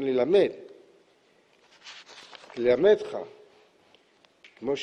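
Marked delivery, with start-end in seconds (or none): none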